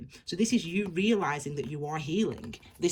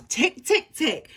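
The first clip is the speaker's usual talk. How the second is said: excited ticking; 10 semitones higher, 8 dB louder, slower than usual. Real, not acted. Ticking off the viewer's worries.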